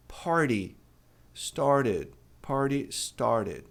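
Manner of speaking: Party started with both T as a quick R tap; flapped T. Shown as a demonstration. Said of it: "T virando re". (Party started com o T virando R)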